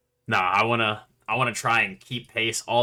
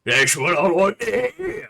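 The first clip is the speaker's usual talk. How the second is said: excited; 11 semitones higher, 10 dB louder than usual. Acted. Character cheers the drinking.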